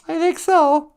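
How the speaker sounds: very high-pitched tiny puppet voice; squeaky sidekick. Pretend. Sidekick answering host about time, squeaky voice.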